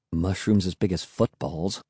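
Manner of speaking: low soft murmur in awe. Acted. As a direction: murmur it to yourself, hushed. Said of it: "I murmured".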